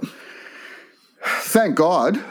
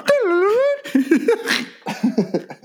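high squeaky noise. Retold or imitated. Bird looking at him.

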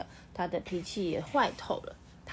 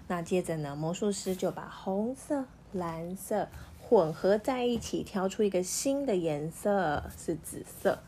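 usual speaking voice

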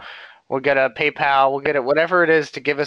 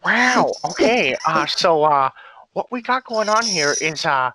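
higher-pitched drawn-out celebrity impression; Owen Wilson; 3 semitones higher than usual. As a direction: open on an awed wow, then ramble casually. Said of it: "doing an impression of Owen Wilson".

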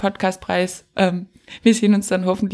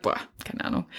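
chuchoté, sans voix, à peine audible; Petrus chuchote; 11 dB quieter than usual. Acted. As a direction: glisse la réponse dans un souffle. Petrus répond à Gott.

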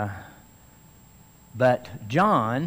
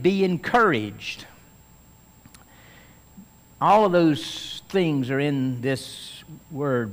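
usual speaking voice

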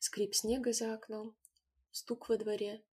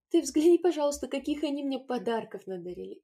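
higher, louder happy call; delighted; 5 semitones higher, 7 dB louder than usual. A narrator's reading. Mother to grandfather, delighted call. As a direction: show off the gifts.

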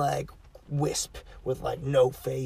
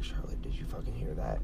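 retold terrified whisper, much softer; scared whisper; 13 semitones lower, 8 dB quieter than usual. Frozen in the dark restaurant, whispering to coworker. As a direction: hiss it like the ghost might hear.

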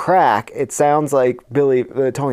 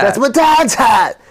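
put-on higher, louder whiny kid echo; repeating the friend's whiny kid line, higher and louder; copying the whiny kid; 10 semitones higher, 6 dB louder than usual. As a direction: mimic the kid's whine back. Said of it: "He really says".

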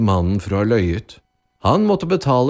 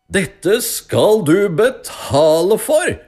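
slow, high, loud furious threat; angry scream; 11 semitones higher, 5 dB louder, slower than usual. A narrator's reading. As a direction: shout in outrage, stretching the words. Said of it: "skrek den rike mannen sint".

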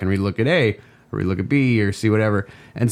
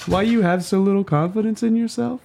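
terrible mom voice; 11 semitones higher than usual. Retold or imitated. Son imitating his mother's worried text in a high voice. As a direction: squeak it out like a fretting mom. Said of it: "so I can do this terrible voice".

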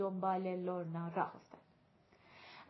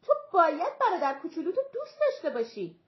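high, loud objection; indignant acted objection; loud protest; 7 semitones higher, 8 dB louder, faster than usual. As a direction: protest with raised voice and pitch. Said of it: "جاناتان به حالت اعتراض گفت".

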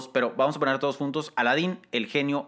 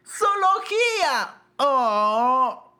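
put-on excited kid voice; 13 semitones higher, 5 dB louder, much slower than usual. Acted kid exclaiming.

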